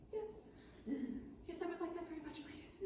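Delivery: small, high, very soft voice; meek acceptance. Acted. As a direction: accept timidly, fading on the please. Lonely gnome meekly accepts.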